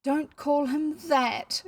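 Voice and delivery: high, drawn-out protest; pained cry. Acted. Emily objecting to Ellen's words, acted pained cry. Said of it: "cried Emily".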